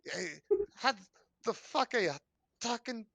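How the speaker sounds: high squeaky goblin voice; high indignant goblin. Acted. Goblin complains of the cold.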